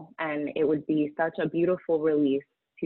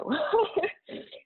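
a high, broken laugh near tears; a real laugh that breaks toward a sob; teary laugh; 14 semitones higher than usual. Real, not acted. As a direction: laugh it off while close to crying. Tearful laugh mid-story.

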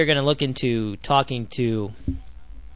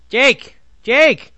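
loud, high-pitched shout to get his attention; calling out; 8 semitones higher, 6 dB louder than usual. Real, not acted. Host hailing his friend on the phone, calling out. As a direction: call out the name, high and loud.

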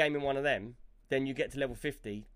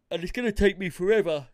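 yawning; 4 semitones higher, 8 dB louder than usual. Real, not acted. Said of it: "sorry, I'm yawning".

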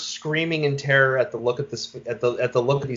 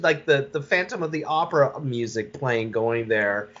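ordinary delivery

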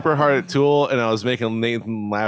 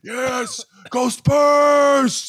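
high yell building louder; fan cheering; 4 dB louder, much slower than usual. Retold or imitated. Retelling cheers after every song, fan yelling. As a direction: holler for the team, stretching the last word.

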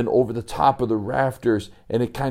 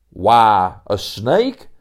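louder hearty backwoods drawl; a visitor greeting grandly at the door; folksy old-timer Sebastian; 6 dB louder, slower than usual. Acted. Sebastian voiced.

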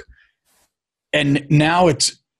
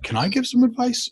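mock eager CEOs; 11 semitones higher, 5 dB quieter than usual. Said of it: "They're like".

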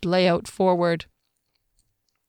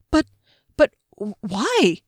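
stammering; 3 semitones higher than usual. A narrator's reading. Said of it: "he stammered in Rydrish".

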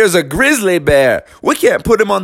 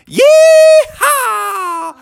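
high, loud rodeo yell; cowboy whoop; 6 dB louder than usual. Acted. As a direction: whoop like a cowboy slapping the bull. Cowboys send off bull.

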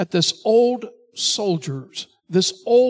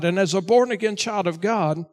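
normal talking voice

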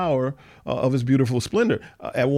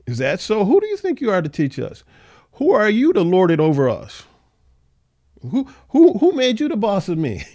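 indignant mock retort; 6 semitones higher, 4 dB louder than usual. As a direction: voice climbing high and loud, offended. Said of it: "they're indignant".

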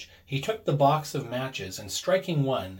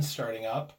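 ordinary delivery, nothing marked

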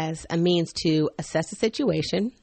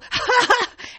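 high mock-maniacal cackle; maniacal laugh; 3 dB louder than usual. Mom taunting toddler in church, mock maniacal laugh. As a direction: cackle like a hammed-up villain. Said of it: "like, maniacal laugh".

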